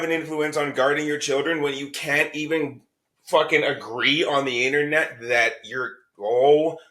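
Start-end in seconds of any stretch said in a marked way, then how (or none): none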